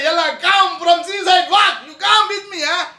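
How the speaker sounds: loud, high call across the shore; retold calling out. Moses calling his people from the seaside, loud high call.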